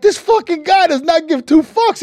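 loud, high incredulous retelling; amazed disbelief. Retold or imitated. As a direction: marvel loudly at someone's nerve. Quarterback's retold amazed reaction.

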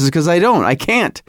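usual speaking voice

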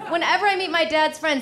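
ordinary talk

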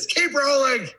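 high, strained frenzied yell; frenzied shout. Retold or imitated. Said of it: "worked himself up into".

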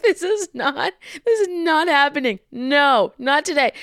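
high, emphatic mock-panicked protest; dramatic refusal. Woman recalling her thoughts at gunpoint, dramatic refusal.

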